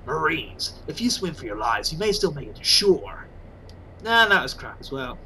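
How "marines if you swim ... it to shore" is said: low, lightly growled soldier voice; a hint of a gruff Snake; low gravel-free soldier's order, lightly voiced; Snake impression. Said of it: "Maybe if I did it as Snake".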